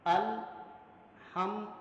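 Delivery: الحمد read very slowly; careful Quranic recitation. Shown as a demonstration. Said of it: "یہ لفظ ہے".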